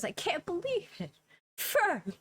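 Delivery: high, tearful cry of relief; overjoyed sob of a grateful father; father reunited with his child, crying out; Frederick tearful. Acted. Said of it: "and he goes".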